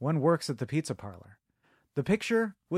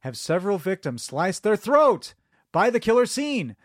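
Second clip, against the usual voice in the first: usual speaking voice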